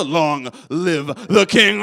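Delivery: slow, sinister villain line; villain Scar quote. Retold or imitated. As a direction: drag it out like a villain. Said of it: "when Scar does it".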